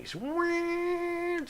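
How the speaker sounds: loud, very high, drawn-out squeal; imitated whine. Retelling the noise that gets so loud, high imitated whine. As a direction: mimic a piercing whine building in the head. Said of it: "it just goes whining".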